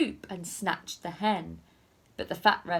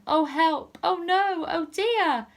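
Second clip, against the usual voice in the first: mock alarm; 9 semitones higher, 5 dB louder than usual. A narrator's reading. Dramatic alarm in story narration.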